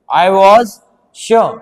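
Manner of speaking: very slow, each word stretched out; dictating word by word, very slowly; slow dictation. Shown as a demonstration. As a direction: spell out I was sure slowly as if dictating for notes. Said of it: "मैंने यहाँ पे लिख दिया sure".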